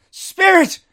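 high, loud desperate cry; Scrooge crying out. Acted. Scrooge clutching the spirit's robe, desperate cry. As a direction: call out to the spirit in despair. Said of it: "he cried".

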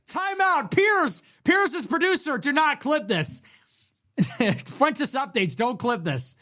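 high-pitched comic alarm; urgent call-out. Real, not acted. Streamer warning a producer off clipping, mock urgent. Calling out to producers not to clip.

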